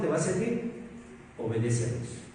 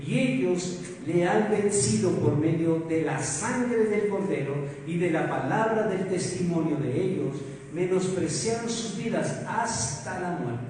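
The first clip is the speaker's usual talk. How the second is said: scripture reading; 3 dB louder than usual. Acted. Reads Apocalipsis aloud.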